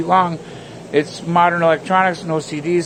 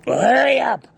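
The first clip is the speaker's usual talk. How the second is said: impatient yell; 9 semitones higher, 3 dB louder, slower than usual. Retold or imitated. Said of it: "was like".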